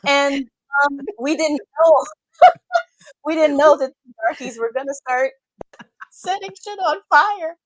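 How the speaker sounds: high, loud mock panic; panicked French tourist. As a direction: squeal it like a frightened tourist.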